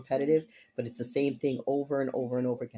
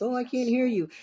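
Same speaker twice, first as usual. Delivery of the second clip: high squeaky complaining voice; mock complaint; 11 semitones higher, 4 dB louder than usual. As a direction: squeak it like a whiny viewer. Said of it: "I've been getting some complaints".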